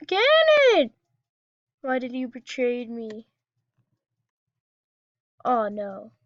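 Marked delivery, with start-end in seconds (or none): 0.1-0.9 shouting. Real, not acted. Cannon attacks, shouting.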